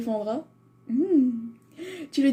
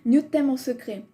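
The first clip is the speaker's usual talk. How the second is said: ordinary talk, nothing marked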